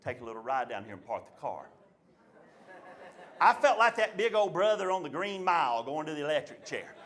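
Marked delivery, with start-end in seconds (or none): 0.0-1.6 lowered dad voice. Retold or imitated. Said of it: "He said".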